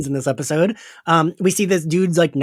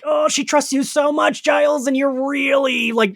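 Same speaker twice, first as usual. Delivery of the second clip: dismayed; 8 semitones higher than usual. Acted. Voices her dismay at Giles's betrayal.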